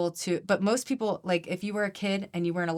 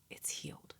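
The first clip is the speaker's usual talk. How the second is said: whispered; 16 dB quieter than usual. Shown as a demonstration. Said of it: "You're like".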